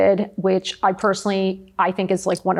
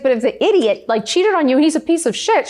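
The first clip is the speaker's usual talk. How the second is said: mom scolding; 7 semitones higher, 3 dB louder than usual. Retold or imitated. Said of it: "She's like".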